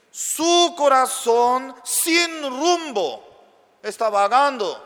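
high, loud fervent preaching; preaching at full force. Acted. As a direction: thunder it from the pulpit. Predicación enfática. (predicando con fuerza)

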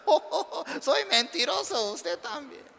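high laughing voice, giggling through it; laughing confession. Retold or imitated. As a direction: laugh it off like a caught liar. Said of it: "una sonrisa grande". (riéndose alegremente al confesar)

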